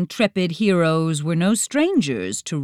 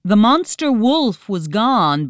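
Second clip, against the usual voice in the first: usual speaking voice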